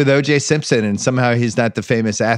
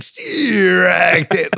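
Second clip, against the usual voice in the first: hammed-up umpire call; 7 semitones higher than usual. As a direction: imitate an umpire milking the call. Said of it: "and then he's like".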